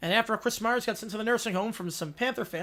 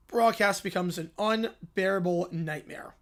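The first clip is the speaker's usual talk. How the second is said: ordinary talk, nothing marked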